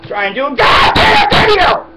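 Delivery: frustrated yell. Real, not acted. Interrupted while filming, real furious yell. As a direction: explode in frustration.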